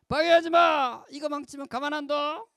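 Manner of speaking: high, loud sisterly outburst; furious high-pitched threat, shouted; angry shout. Acted. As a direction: yell a warning at a pesky little brother. Acted sister yelling at brother.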